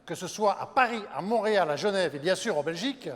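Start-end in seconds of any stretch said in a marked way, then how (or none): none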